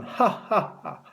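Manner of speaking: high, hammed-up ha-ha-ha; gloating villainous matron laugh; mocking laugh. A narrator's reading. Matron laughs at own jibe.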